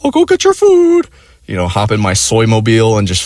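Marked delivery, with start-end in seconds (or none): 0.0-1.1 very high squeaky mock voice; mocking her fake willingness in a squeaky falsetto; high mocking girlfriend. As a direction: squeak it out like a cartoon. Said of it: "She's like".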